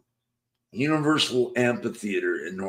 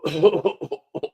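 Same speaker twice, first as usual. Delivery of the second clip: laugh; 9 semitones higher, 6 dB louder than usual. Real, not acted. Laughing at own joke.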